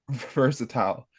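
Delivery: versatile ending like "tile"; British pronunciation. Shown as a demonstration. Host confessing his habit, British pronunciation of versatile. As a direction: say versatile the UK way, last syllable as "tile". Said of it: "That's how they pronounce it in the U K".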